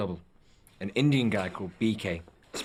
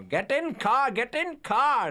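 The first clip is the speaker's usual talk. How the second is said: put-on high, loud bossy shout in Indian accent; 3 dB louder, slower than usual. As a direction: bark orders in an Indian accent. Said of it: "B K shouting at Viggo".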